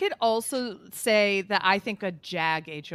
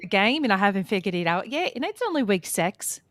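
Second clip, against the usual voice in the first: put-on Bowie Jane in a higher, louder Australian accent; mock Australian accent; 4 semitones higher, 5 dB louder, faster than usual. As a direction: show how the Australian accent should go. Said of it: "you gotta do more like this".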